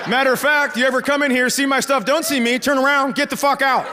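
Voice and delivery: high-pitched mock tirade; indignant homeowner. Dad ordering his daughter's boyfriend out, indignant. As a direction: rant like an affronted homeowner.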